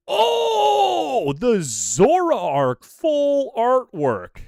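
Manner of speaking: loud, high "oh", then slow relish; excited awe. Real, not acted. Streamer pulling a Zoroark full art, excited awe. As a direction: gush over it, stretching the words. Pulls a prized card.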